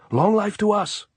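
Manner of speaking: high, loud cheer; shouting. A narrator's reading. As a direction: shout a toast to comrades. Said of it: "Andres shouted".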